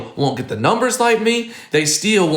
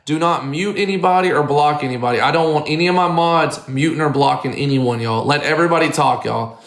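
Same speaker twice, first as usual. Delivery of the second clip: ordinary talk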